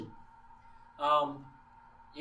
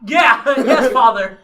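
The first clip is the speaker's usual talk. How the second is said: loud laughing yes; 4 semitones higher, 14 dB louder, slower than usual. Retold or imitated. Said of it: "He goes".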